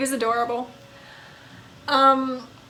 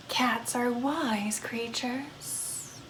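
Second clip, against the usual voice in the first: slow, hushed whisper; 11 dB quieter, much slower than usual. Acted. Vampire confiding her love of cats, whispered. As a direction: share it like a secret.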